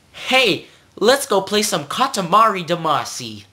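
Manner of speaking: put-on high, loud American-accented "Katamari Damacy"; American mispronunciation. Teacher mimics gamers mangling Japanese, in exaggerated American accent. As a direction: play an eager English speaker with American R. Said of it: "that's how some people pronounce it".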